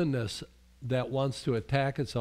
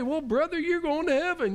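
mock preacher; 12 semitones higher than usual. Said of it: "mocking the easy-salvation preacher".

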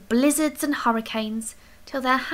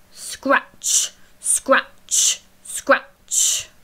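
scratching noise; much slower than usual. A narrator's reading. Noise under the bed.

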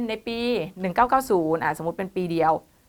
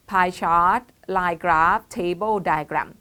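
slow read-out list; 5 dB louder, much slower than usual. Shown as a demonstration. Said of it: "คุณก็ใช้ bar chart เห็นไหมคะ".

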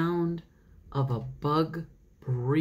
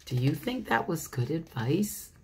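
usual speaking voice

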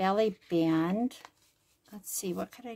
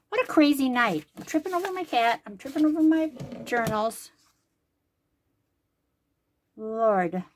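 exasperated outburst; 4 semitones higher, 7 dB louder than usual. Real, not acted. Tripping over cat and journals.

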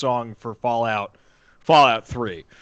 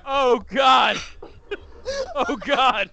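horrified shout; 9 semitones higher, slower than usual. Real, not acted. Horror at the snack.

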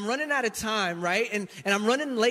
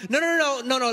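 higher, louder string of no's; loud protest; 4 semitones higher, 5 dB louder, faster than usual. Retold or imitated. Husband refusing to just have dinner, higher and louder no's. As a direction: refuse to drop it, insistently.

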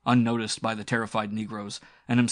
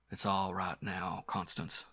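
panting; 7 dB quieter than usual. A narrator's reading. Hero comforts the girl after the fight, panting. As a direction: reassure her softly while spent. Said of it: "he panted".

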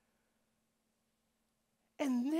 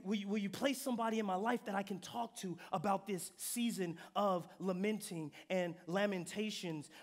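normal talking voice

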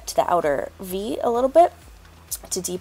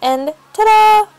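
a big, drawn-out, much higher "ta-da" reveal; excited ta-da; 14 semitones higher, 12 dB louder, slower than usual. Real, not acted. Vlogger revealing her finished brows to viewers, triumphant. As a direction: unveil it like a magic trick, loud and stretched.

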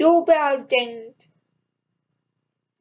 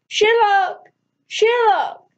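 calling out louder and higher; raised-voice call, mildly acted; yelling; 5 semitones higher, 4 dB louder, slower than usual. A narrator's reading. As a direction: yell the name to get his attention. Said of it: "I yelled".

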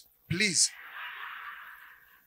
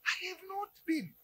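timid denial; 9 semitones higher, 12 dB quieter than usual. Acted. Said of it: "And you say".

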